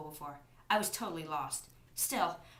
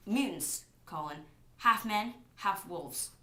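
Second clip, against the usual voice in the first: plain reading voice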